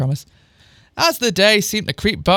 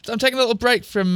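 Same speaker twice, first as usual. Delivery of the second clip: ordinary delivery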